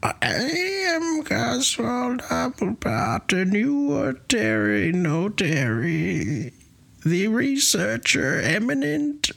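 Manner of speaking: high, slow, eerie otherworldly voice; Coswald Doublepot. Acted. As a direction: proclaim it slowly and high, sinking at the end. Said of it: "you're gonna do Coswald".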